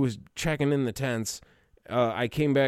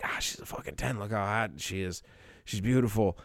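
retold aside, much softer; hushed admiration; 3 semitones lower, 6 dB quieter than usual. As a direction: murmur it like a private aside. Quoting his hushed admiration aside.

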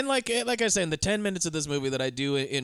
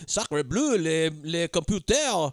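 slow, hammed-up French accent; mock French; much slower than usual. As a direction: draw it out in a silly French accent. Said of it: "foreign people that I'm not gonna do the voices of".

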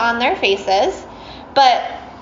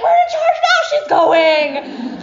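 crowd cheer; slower than usual. Retold or imitated. Audience gleefully taking charge.

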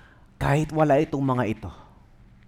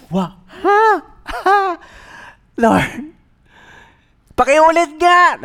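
high, loud shocked disbelief; did I hear that right; stunned, asking God to repeat; incredulous; 13 semitones higher, 7 dB louder, slower than usual. Retold or imitated. Can't believe instruction.

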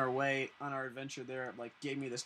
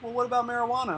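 a higher, louder mock cop voice; imitating the cop; 8 semitones higher, 7 dB louder, faster than usual. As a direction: pitch the cop's question up, lightly mocking. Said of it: "He's like".

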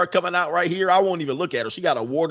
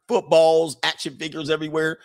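ordinary talk, nothing marked

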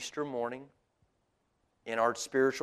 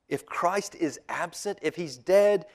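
higher and louder, rising to all is darkness; building intensity; 5 semitones higher, 10 dB louder than usual. Real, not acted. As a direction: build from slow to a loud peak. Preacher building to climax.

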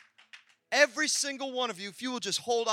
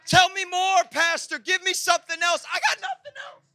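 mock eager listener; 8 semitones higher, 8 dB louder than usual. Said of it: "congregation pleading".

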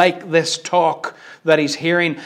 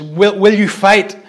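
raised, loud battle challenge; rallying shout; 4 semitones higher, 6 dB louder than usual. Retold or imitated. William Wallace shouts to the amassed armies, shouting. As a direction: call out to the troops. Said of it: "he shouts at them".

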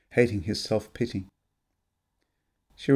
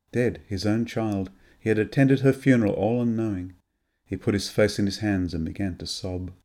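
usual speaking voice

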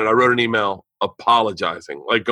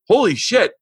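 shocked; 9 semitones higher than usual. Retold or imitated. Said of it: "and I'm like".

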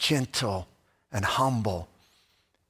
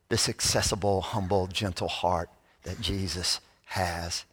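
usual speaking voice

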